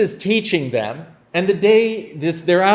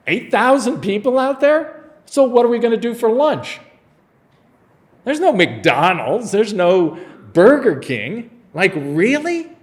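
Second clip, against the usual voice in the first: higher, playful mock-serious question; teasing Jesus; 5 semitones higher, 3 dB louder than usual. Jesus quizzes the disciples about lunch, higher and playful. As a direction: tease the disciples with a grin.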